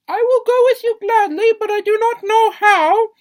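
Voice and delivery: shrill it out like a helpless fairy-tale princess; a high, loud, cartoonish damsel; Rapunzel. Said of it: "When Rapunzel says".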